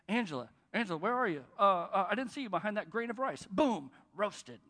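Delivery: loud, hammed-up Michael Scott taunt; Michael Scott roast. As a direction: jeer at the coworker for laughs. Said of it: "Michael Scott... making fun".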